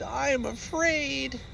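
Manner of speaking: high, slow and small; a cub wanting home; slow high fearful voice; frightened cub. Acted. Baby bear afraid.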